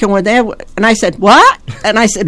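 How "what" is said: higher, louder incredulous question; retold disbelief. Customer hearing the mechanic's news, re-enacting startled disbelief. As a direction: re-enact being taken aback. Reacting to week-long repair news.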